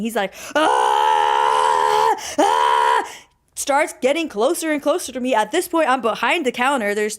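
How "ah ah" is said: long, low yelled "ah"; imitated screaming. Imitating the man coming at her, screaming. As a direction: mimic a man's drawn-out low scream. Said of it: "He starts screaming".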